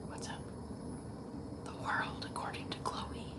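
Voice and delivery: hushed, voiceless chat with a pet; whispering. Real, not acted. Owner telling Chloe it's snowing, whispering. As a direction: whisper gently so as not to disturb. Whispering to cat watching birds and snow.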